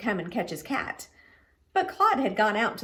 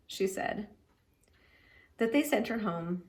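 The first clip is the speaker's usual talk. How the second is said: ordinary delivery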